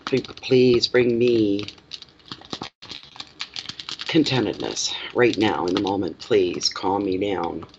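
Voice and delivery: slow, hushed plea for calm. Real, not acted. Reader praying to calm herself before reading, slow and hushed.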